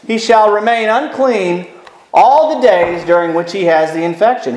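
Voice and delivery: measured, solemn reading of the verse; scripture reading. Acted. Preacher reading Leviticus to the congregation, solemn.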